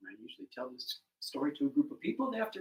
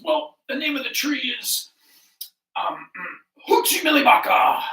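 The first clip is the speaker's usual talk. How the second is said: loud stalling, then a booming made-up name; bluffing lion; 9 semitones higher, 13 dB louder than usual. Acted. Lion guesses before the doubting animals, loud and blustering. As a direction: clear the throat and proclaim it grandly.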